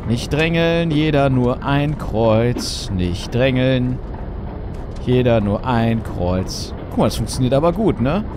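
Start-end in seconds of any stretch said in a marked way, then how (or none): 0.1-2.9 slow drawn out. Shown as a demonstration. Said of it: "repeats the line slower". 2.9-6.7 slow drawn out. Shown as a demonstration. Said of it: "repeats again slower".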